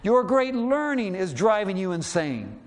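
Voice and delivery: loud, slow accusing shout. Acted. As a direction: keep shouting the charge at him. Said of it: "he shouted".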